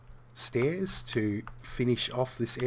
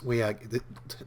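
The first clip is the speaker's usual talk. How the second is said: usual speaking voice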